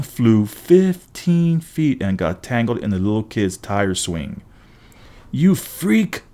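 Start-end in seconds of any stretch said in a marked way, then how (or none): 5.3-6.2 mild acted yell. A narrator's reading. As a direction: voice a bully's yell without real volume. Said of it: "Sloan yelled".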